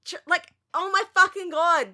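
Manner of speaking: loud, high, baffled outburst; exasperated rant. Real, not acted. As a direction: vent at the screen in disbelief. Ranting about Friends roommates.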